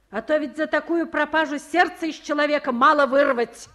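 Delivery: loud scolding threat down the phone; angry outburst. Acted. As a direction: let the anger burst out on this line. Threatening over lost shoes.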